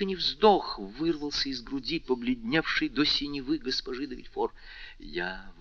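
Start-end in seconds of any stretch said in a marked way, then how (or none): 5.0-5.5 slow frightened whisper; тихо, шёпотом. A narrator's reading. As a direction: barely breathe the words out, slowly. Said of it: "тихо сказала она". (испуганный медленный шёпот)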